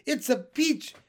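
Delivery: high, loud excited cry; shouting. Acted. Spiker shouting to Sponge, excited and loud. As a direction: announce the peach with a shout. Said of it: "Aunt Spiker was shouting".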